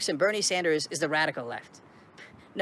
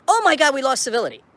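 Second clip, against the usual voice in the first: louder, higher mock-outraged gasp; sarcastically imitating cable news pearl-clutching; mocking pundit voice; 6 semitones higher, 7 dB louder than usual. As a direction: ham up the pundits' fake horror. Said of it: "twenty-four seven coverage of".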